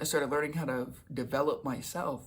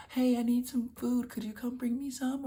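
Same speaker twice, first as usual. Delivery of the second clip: put-on high, pleading girlfriend voice; 10 semitones higher than usual. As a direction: ask sweetly for a favor, pitched well up. Ex-girlfriend voiced asking favors.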